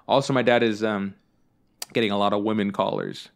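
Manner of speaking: usual speaking voice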